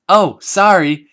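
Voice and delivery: bright, loud, slow chirp of a fake apology; cheerfully unsorry. Pinkie dropping her friends, cheerfully unapologetic and loud. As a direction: chirp it, not sorry at all. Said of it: "Sounding like the least sorry person".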